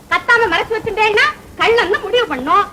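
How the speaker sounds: normal talking voice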